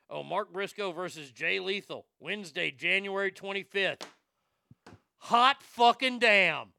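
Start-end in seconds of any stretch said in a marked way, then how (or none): 5.2-6.7 loud, slow, thrilled exclamation; excited shout. Real, not acted. Fan hearing great wrestling news, shouting with joy. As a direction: belt it out in delight.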